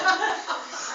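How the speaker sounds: breathy high laugh; burst of laughter. Real, not acted. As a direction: let a laugh break out. Laugh mid-story.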